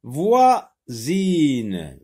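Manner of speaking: Voisine loud and stretched, split before a buzzing z; sounded-out Z. Shown as a demonstration. Teacher modelling a word for the Z sound, loudly sounded out. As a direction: sound out Voi-sine slowly with a clear z. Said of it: "j'entends Z et je n'entends pas S".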